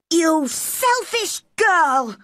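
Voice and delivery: high, drawn-out accusation; indignant cry. Acted. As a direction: cry out slowly in outrage, high-pitched. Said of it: "cried Colin".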